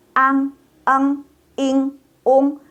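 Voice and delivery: Ang, eng, ing, ung, high and level; model ng endings. Shown as a demonstration. Teacher models four sounds like Indonesian, high sustained drill. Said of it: "sama dengan bahasa Indonesia".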